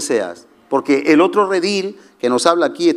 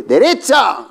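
the Lord commanding; 6 semitones higher, slower than usual. Retold or imitated. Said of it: "el Señor te dice".

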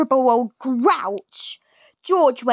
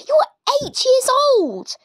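put-on much higher, scornful old woman; snorting Grandma; 13 semitones higher, 4 dB louder than usual. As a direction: snort it at the boy. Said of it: "she snorted".

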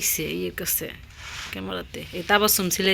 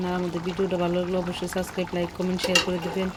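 rushed outro; faster than usual. Real, not acted. Routine subscribe outro to viewers.

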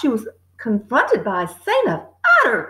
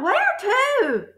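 high, startled question; startled girl's high voice; cried out; 9 semitones higher than usual. A narrator's reading. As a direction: cry it out in surprise. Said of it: "Matilda cried out".

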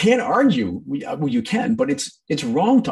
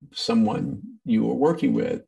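ordinary talk

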